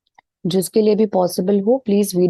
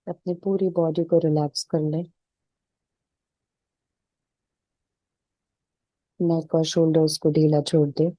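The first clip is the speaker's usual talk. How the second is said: even, unhurried meditation voice with little pitch movement; settled, level relaxation-session delivery; guided meditation; 3 dB quieter than usual. Real, not acted. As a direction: guide them calmly into stillness, leaving space between phrases.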